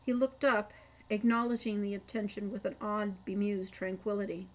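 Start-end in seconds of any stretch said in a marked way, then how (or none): none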